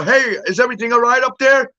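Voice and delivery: acted questioning call; calling upstairs. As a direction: call up to check, without shouting. Acts Ethan calling upstairs.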